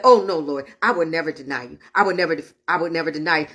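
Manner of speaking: low-pitched, imploring denial; low mock-solemn protest; protesting disciple. Peter protests to Jesus, repeated.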